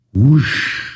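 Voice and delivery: mock train whoosh. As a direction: voice the train rushing by. Train passes by.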